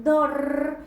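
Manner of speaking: "dor" punched and drawn out; stressed syllable. Shown as a demonstration. Teacher showing where the accent is, emphatic long "dor". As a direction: lean hard on "dor" and hold it. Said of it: "la sílaba que suena con mayor intensidad".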